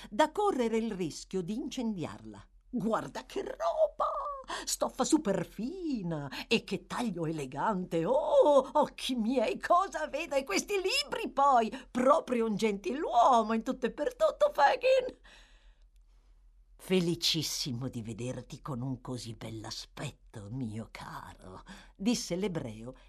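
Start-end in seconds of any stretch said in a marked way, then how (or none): 2.7-15.2 high, loud mock admiration; mocking boy. A narrator's reading. Charlie mocks Oliver's clothes. 16.8-21.6 bow and purr with fake humility. A narrator's reading. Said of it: "con farsesca umiltà".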